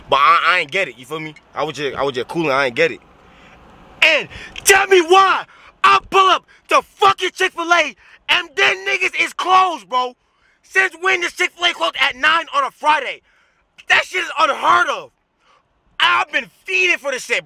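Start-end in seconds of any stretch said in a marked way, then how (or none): 4.0-10.1 furious shout. Real, not acted. Ranting about Chick-fil-A being closed. 10.6-17.5 high, indignant disbelief; outraged rant. Real, not acted. Still ranting about Chick-fil-A.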